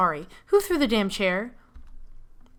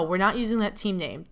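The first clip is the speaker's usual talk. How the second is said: ordinary talk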